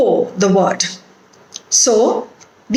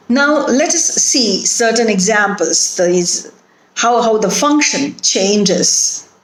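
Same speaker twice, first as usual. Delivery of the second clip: normal talking voice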